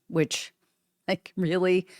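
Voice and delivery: normal talking voice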